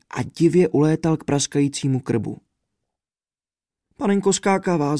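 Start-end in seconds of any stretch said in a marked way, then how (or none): 4.0-5.0 high, sobbing exclamation; a princess caught in a sob; sobbing cry. A narrator's reading. The princess sobs as the contract flies into the fire, high and tearful. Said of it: "zavzlikla princezna".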